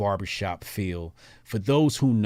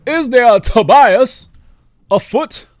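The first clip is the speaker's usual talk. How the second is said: much higher, much louder hammed-up Tobias cry; 12 semitones higher, 14 dB louder, slower than usual. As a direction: burst out as Tobias in mock alarm. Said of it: "Tobias ... voice".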